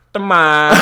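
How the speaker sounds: loud mock Texas accent; Southern Texas boy. Repeating the film character's one line, loud Texas impression. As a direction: blurt it with a hammed-up Texas twang. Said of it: "classic Southern Texas boy".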